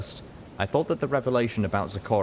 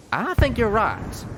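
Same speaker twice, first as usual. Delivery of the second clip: higher folksy Southern voice; Southern pony drawl; 4 dB louder, slower than usual. A narrator's reading. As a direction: tease her like a country colt. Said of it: "Calamity agreed".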